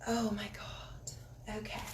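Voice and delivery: slow, soft whisper of amazement; awed whisper. Real, not acted. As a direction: breathe it out in awe. Reacting to drawn card.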